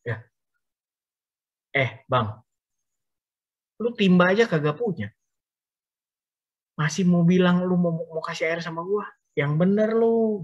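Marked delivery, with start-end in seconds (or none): 1.8-10.4 higher, scoffing "Yang bener lu"; mock her doubt like a sassy neighbour; skeptical disbelief. Woman doubting Jesus has any water, higher and scoffing. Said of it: "Empok ini bingung".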